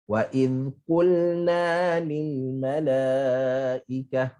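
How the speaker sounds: slow chanted recitation; Quran recitation. Shown as a demonstration. Teacher recites the verse to show correct qulna. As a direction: recite it slowly, measured and melodic.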